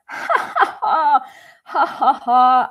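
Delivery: high, loud staged ha-ha-ha; hammed-up burst of teasing laughter; mocking laughter. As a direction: act out kids laughing at someone. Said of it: "the kids start laughing so much".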